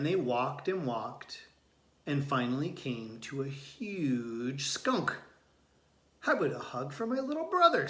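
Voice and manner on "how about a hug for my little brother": big sister asking. A narrator's reading. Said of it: "said Thea".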